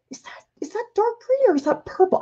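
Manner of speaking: whisper. Real, not acted. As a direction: whisper it, puzzling over the color. Squinting at colors, asking herself.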